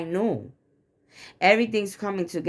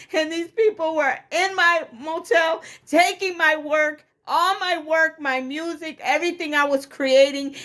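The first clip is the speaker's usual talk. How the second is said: distraught outrage; 10 semitones higher than usual. Real, not acted. Woman recounting people taking her work and energy, distraught. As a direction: cry out each grievance far above your usual pitch. Distraught account of being robbed.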